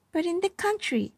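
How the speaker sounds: put-on high, proud boy; boy Walter. A narrator's reading. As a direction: answer proudly, like a country kid. Walter answers about country.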